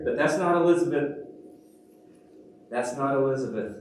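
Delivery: normal talking voice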